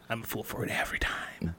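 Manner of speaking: breathy whispered confession; whispering. Real, not acted. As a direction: whisper it like a guilty secret. Admitting weakness, whispered.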